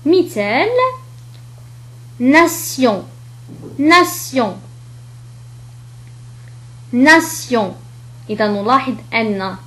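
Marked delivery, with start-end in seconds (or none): 2.2-3.0 "ناسيون" with s, then a nasal on. Shown as a demonstration. Teacher showing T before io, s in "ناسيون". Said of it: "كحرف السين". 3.8-4.6 "ناسيون" with s, then a nasal on. Shown as a demonstration. Teacher showing T before io, s in "ناسيون". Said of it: "كحرف السين". 6.9-7.7 "ناسيون" with s, then a nasal on. Shown as a demonstration. Teacher showing T before io, s in "ناسيون". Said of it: "كحرف السين".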